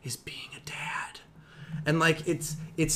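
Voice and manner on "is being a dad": whispered, softer, overly serious; mock whisper. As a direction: whisper it like a solemn ad. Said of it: "needs to be read in a whisper".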